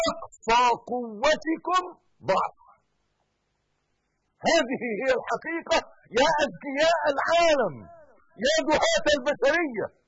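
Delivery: loud, high, rising proclamation; fiery preaching. Acted. Preacher proclaiming the truth to believers, loud. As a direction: drive the truth home to the faithful. Sermon climax at full force.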